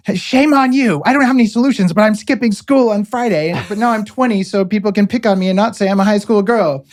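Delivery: high-pitched mocking voice of a scolding schoolgirl activist; Greta Grundelberg impression. Mocking activists who just bully people, high scolding schoolgirl impression. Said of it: "you sound like Greta Grundelberg".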